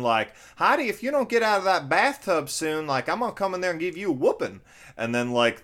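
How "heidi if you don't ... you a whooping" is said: put-on super strong Southern accent. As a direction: ham up a thick Southern scolding. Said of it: "super strong Southern".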